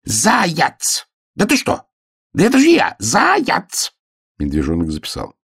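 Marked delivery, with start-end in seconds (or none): 0.0-3.9 very high, desperate-to-be-known hare cries; squeaky exasperated outcry; crying out. A narrator's reading. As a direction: shout it high in disbelief. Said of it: "и вдруг закричал".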